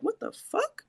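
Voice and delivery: very high, soft, baffled question; mock bewilderment. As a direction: act out hushed, high-pitched disbelief. Acting out hearing unexplained screams.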